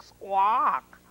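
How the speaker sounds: loud squawk with an odd voice; peacock squawk. A narrator's reading. Peacock nearly sat on by a hippo, loud squawk. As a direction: squawk like a squashed bird.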